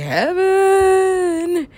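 high, long-held drawn-out word; acted rapture. Acting out repeated answer.

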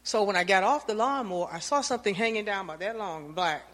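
ordinary talk, nothing marked

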